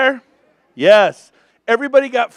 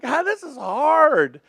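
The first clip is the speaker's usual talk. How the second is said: mock lament; 7 semitones higher, slower than usual. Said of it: "I'm like".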